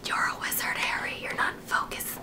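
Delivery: breathy whispered movie quote. Retold or imitated. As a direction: hush it like a secret spell. Quoting Harry Potter line.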